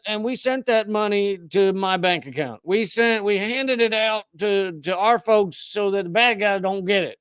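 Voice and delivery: lightly voiced Southern US soldier; Southern accent. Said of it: "I'm gonna do a, a Southern accent like this is the U S military".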